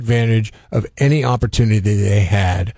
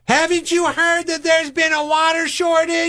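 much higher, prim mock-scold of a nosy neighbor; snooty neighbor voice. Retelling the nosy neighbor who scolds her washing the car, high mock-prim voice. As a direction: pitch it way up like a busybody tut-tutting.

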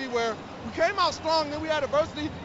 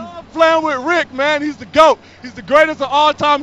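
fired up; 12 dB louder than usual. Real, not acted. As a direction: shout his teammate's praises. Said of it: "fired up right now".

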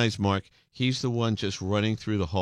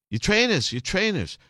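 insistent Scouse imitation, pitched much higher; Liverpool accent; 10 semitones higher than usual. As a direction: repeat it emphatically as a Scouse stage manager. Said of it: "the stage manager said".

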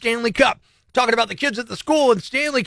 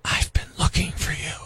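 creepy whisper; 6 semitones lower, 7 dB quieter than usual. Shown as a demonstration. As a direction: breathe it like a stalker. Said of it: "But if I say".

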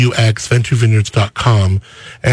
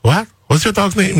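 lightly mimicked Italian accent, raised pitch; imitated Italian accent; 7 semitones higher than usual. Host imitates the Italian chef asking about the dog, light Italian accent. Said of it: "like, perfect little French accent".

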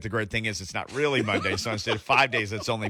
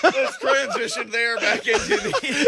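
high, slow, laughing through it; barely holding it together; 12 semitones higher, 7 dB louder, slower than usual. Real, not acted.

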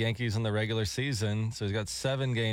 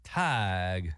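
lower, long Southern drawl on "Tig"; [name]'s Southern accent; 5 semitones lower than usual. Retold or imitated. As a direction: draw "Tig" out low, imitating a Southern country singer. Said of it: "but [name] would say Tig".

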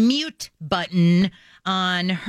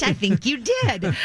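laughing through the words; amused laughing; 4 semitones higher, slower than usual. Real, not acted. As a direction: chuckle while teasing. Laughing at cohost.

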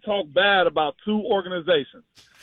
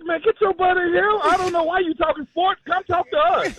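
pitched-up mock boss calling him back in; mock station boss; 9 semitones higher than usual. Station boss ordering Slay back, pitched-up mock voice. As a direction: play the boss, higher and bossy. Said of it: "they'd be like".

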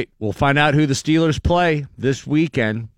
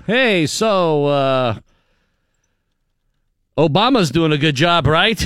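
mock interviewer; 6 semitones higher, 6 dB louder, slower than usual. Imagining a fake interview.

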